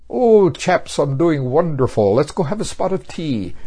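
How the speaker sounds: high, loud, hammed-up English accent; jolly mock-English gentleman. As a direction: play a Texan gone English, with a mock English accent. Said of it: "you're talking like an Englishman".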